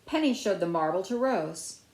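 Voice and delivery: plain reading voice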